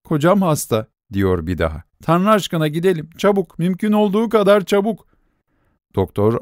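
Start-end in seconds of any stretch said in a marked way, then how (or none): 0.0-0.9 high, tearful plea; holding back sobs. A narrator's reading. Nelli to the sleepy doctor, fighting tears. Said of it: "Hıçkırıklarını güç tutan Nelly". 2.0-5.1 higher, pleading and urgent; urgent plea. A narrator's reading. Nelli urging the doctor to come at once, pleading.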